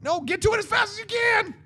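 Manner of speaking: high, strained yelled order; hurried shouting. Acted. Mimicking modern movies' rush, urgent high yell. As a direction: urge them on in a high mock yell.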